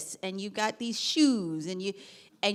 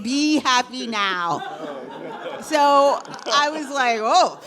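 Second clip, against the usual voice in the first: laughing; 3 semitones higher, 11 dB louder, slower than usual. Retold or imitated. Retold mother's punchline, laughing.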